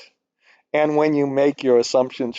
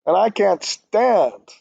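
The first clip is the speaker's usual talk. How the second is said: slow, higher, fed-up outburst; exasperated rant; 7 semitones higher, slower than usual. Real, not acted. Venting to viewers about a messy model, slow exasperated outburst. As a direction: let the frustration spill over, dragging it out. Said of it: "I'm sorry about going crazy".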